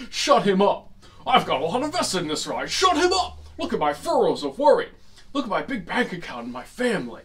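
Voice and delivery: loud, indignant protester in a comic bit; hammed-up angry heckling; outraged shouting. Acting out outraged riders in quote.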